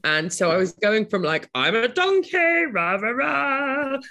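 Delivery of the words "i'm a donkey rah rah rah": booming high Shakespearean ham; slow, grand, overacted Bottom; hammy stage actor. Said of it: "that's Shakespearean".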